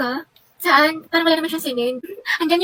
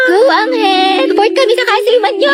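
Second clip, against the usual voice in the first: teasing cheer; 5 semitones higher than usual. Acted. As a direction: cheer the love team on. Friends cheer on the couple. (malakas na pang-aasar na suporta)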